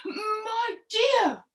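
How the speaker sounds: high-pitched shocked husband; scandalised storybook crocodile. Acted. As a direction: protest in dismay to a wife. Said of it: "Mister Crocodile was very shocked".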